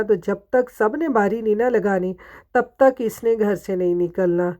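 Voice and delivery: ordinary talk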